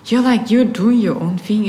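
usual speaking voice

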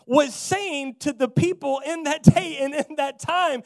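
loud, high, rousing sermon climax; full-force preaching. Real, not acted. Preacher proclaims the debt paid to congregation, full-force preaching.

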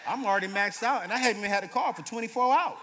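laughing to the congregation about maxing the card; loud laughing exclaim. Real, not acted. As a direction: belt it out in disbelief at yourself. Laughing at his own debt.

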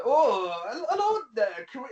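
high, put-on failed accent; Adrienne Barbeau accent attempt. Imitating the accented girl from the film for the podcast. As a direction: try to sound like Adrienne Barbeau in Scooby-Doo. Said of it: "she sounds like Adrienne Barbeau".

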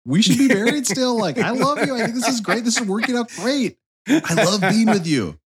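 high, sweet pleading to his wife; lovestruck husband. Pretend. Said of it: "I'd still be like".

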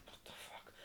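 barely audible whispered curse; muttered disbelief. Retold or imitated. Climber to himself over an unmoving injured man, whispered disbelief. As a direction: swear under your breath.

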